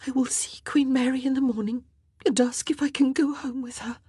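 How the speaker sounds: tearful, half-whispered decision; composing herself after tears. A narrator's reading. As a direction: speak hushed after a long cry, gathering resolve. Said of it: "She took a deep breath".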